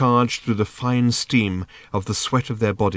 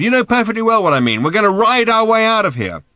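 high, loud and fast; exasperated shouting; 12 semitones higher, 7 dB louder than usual. A narrator's reading. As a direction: shout it urgently, pitched right up. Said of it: "shouted Ford".

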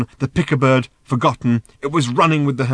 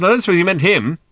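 high, loud remark; raised, high-pitched voice; shouting; 8 semitones higher, 6 dB louder than usual. A narrator's reading. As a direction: keep yelling over the din. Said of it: "shouted Ford".

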